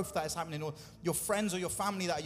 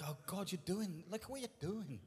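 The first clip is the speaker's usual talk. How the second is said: soft, lifted voice of amazement; hushed awe, pitch rising; acted wonder; 3 semitones higher, 8 dB quieter than usual. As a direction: marvel softly at what God does. Acting out awe at God.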